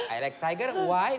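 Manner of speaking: ordinary talk, nothing marked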